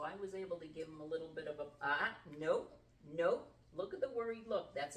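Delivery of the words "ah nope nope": loud, firm nope to the dog; sharp correction. Real, not acted. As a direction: snap a warning at the dog.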